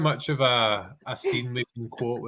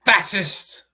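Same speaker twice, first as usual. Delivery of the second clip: high, loud outburst; loud mock accusation; shouted quote; 8 semitones higher, 6 dB louder than usual. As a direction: call it out sharply and high. Shouts film quote in accent contest.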